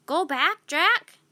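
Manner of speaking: a parrot speaking like a person. A narrator's reading. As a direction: say it like a wise parrot. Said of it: "she said".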